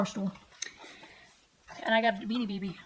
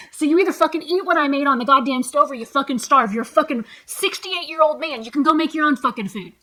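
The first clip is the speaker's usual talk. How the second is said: loud, heated telling-off; worked-up retort at full volume; angry rant; 3 semitones higher, 7 dB louder than usual. Retold or imitated. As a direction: let him have it, loudly. Retelling her angry row with dad.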